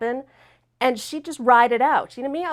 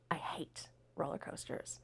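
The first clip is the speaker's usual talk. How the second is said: near-whisper, much softer; low, hushed aside; hushed confession; 5 semitones lower, 19 dB quieter than usual. Real, not acted. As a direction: drop to a whisper for the admission. Confessing her dread, dropped very low.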